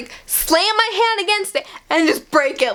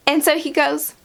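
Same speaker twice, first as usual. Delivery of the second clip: ordinary talk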